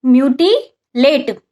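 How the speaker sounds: "Mutilate" drawn out slowly, syllable by syllable; slow repeat. Shown as a demonstration. Teacher repeating the word for students, slowly. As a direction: spell "mutilate" out for dictation. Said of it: "क्या?".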